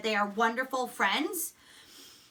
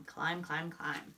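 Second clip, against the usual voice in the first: fading repetition; 7 semitones lower, 10 dB quieter, slower than usual. Shown as a demonstration. Teacher softly repeating the word climb. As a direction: climb repeated, growing softer and lower.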